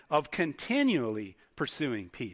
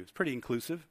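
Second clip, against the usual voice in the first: normal talking voice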